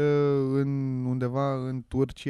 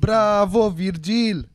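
loud, hammed-up bravo for a friend; mock cheer; 5 semitones higher, 8 dB louder, much slower than usual. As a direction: shout the congrats like a fake fan. Mock congratulations to losing friend.

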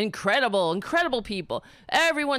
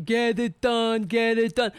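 mock scream. Host mocking the president's claim of screaming workers, mock yell. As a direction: imitate someone barking orders, stretching it out. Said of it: "They're in there screaming".

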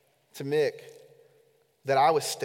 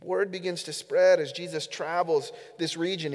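ordinary delivery

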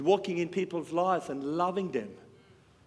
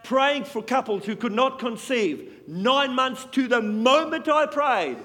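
loud, slow, ringing testimony from the pulpit; full-force preaching; 5 semitones higher, 6 dB louder, slower than usual. Acted. Preacher to congregation, hammering out miracle stories at full volume. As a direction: proclaim each miracle to the crowd.

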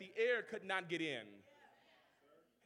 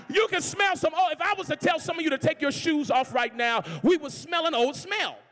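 loud, high sermon delivery; preaching; 6 semitones higher, 10 dB louder than usual. Acted. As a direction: lift it up for the church. Preaching climax.

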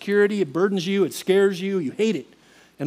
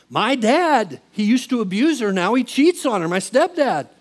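higher, louder upset protest; retold angry complaint; 5 semitones higher, 5 dB louder than usual. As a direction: act out airing a bitter grievance.

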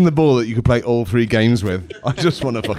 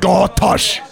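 higher, exaggerated Scottish demo; mock Scottish accent; 8 semitones higher, 4 dB louder than usual. Shown as a demonstration. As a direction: blurt it in a daft Scottish accent. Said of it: "Say Scottish at me right now".

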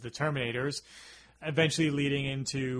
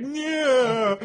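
mock triumph; 10 semitones higher, 5 dB louder than usual.

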